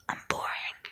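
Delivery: a soft, breathy, mock-weepy "I'm boring"; mock sad whisper. Real, not acted. Vlogger mock-lamenting her dullness to viewers, whispered. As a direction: whisper "I'm boring" as a half-joking whimper. Whispered self-pitying repeat.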